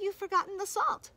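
high, loud singsong chiding; teasing reproach. Acted. Boy telling the wolf what is missing, high teasing reproach. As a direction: lift it high as a gleeful gotcha.

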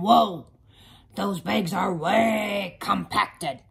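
loud, booming truck character; garbage truck voice. Acted. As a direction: boom it like a hungry truck. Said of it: "garbage truck voice".

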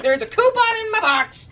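excited repeat. Pretend. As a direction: squeal out the news of the coupon. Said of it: "I repeat".

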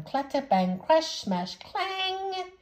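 bang out each noise; clattering sound words. Acted. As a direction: reader acting out falling saucepans. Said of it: "sound words acted out".

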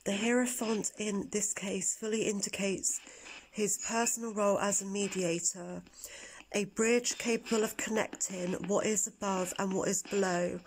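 hushed, whispered card reading. Acted. Reads tarot card meaning.

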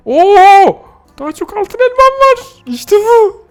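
squeaky gloat over loot; gleeful squeal. Acted. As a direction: squeal like a greedy kid over treasure.

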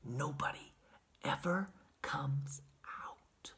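very soft, slow whisper with pauses; hushed, spaced-out, mysterious whisper; secret whisper. A narrator's reading. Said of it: "secret whisper".

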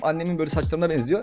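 normal talking voice

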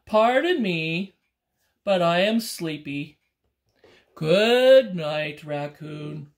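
loud, slow bear voice; a drowsy bear bidding good night; sleepy bear. Acted. Bear saying good night to raccoon, slow and sleepy.

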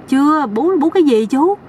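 puzzled question, pitched high; naive bewilderment. Acted. Young man baffled by the uncle's question, naively bewildered. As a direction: ask innocently, without a clue. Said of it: "giống như một cái thằng ngáo ngây thơ".

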